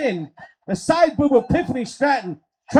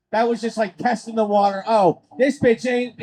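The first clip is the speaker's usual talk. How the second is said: usual speaking voice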